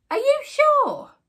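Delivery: squeaked. Acted. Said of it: "squeaked Mister Mouse".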